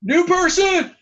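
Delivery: high, loud eager cry; excited shout. Acted. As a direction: yell it with glee. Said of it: "It's like".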